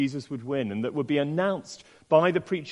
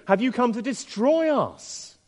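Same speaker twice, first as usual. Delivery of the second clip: high, slow challenge, voicing the demon; demon crying out; 8 semitones higher, 4 dB louder, slower than usual. The demon questions Jesus, crying out. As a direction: draw out the question high, falling on the end. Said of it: "speaks and cries out".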